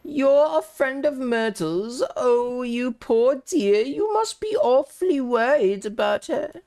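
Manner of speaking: a nosy, kindly neighbour cooing over gossip; sweet gossiping old lady. Acted. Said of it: "such an authentic voice for that character".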